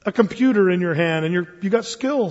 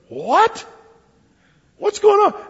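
high, loud bursting shock; startled crowd; 13 semitones higher, 4 dB louder, slower than usual. Acted. Posh crowd sees a child at the piano, shocked. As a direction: gasp at the kid on stage.

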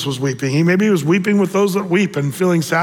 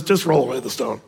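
lower, softer patient command; calm command; 5 semitones lower, 4 dB quieter than usual. Acted. Jesus answering Martha, lower calm command. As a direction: quietly insist. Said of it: "but Jesus says".

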